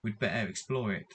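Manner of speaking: usual speaking voice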